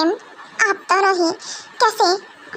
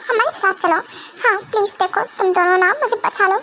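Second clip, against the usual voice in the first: ordinary talk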